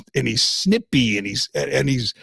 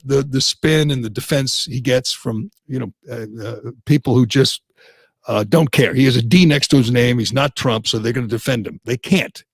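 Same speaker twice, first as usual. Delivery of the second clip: ordinary talk, nothing marked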